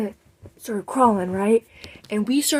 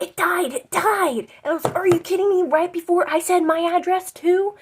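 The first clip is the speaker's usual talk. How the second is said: higher, louder frantic disbelief; frantic girls; panicked; 10 semitones higher, 7 dB louder than usual. Retold or imitated. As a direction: blurt it in a panic. Panic over dead iPod.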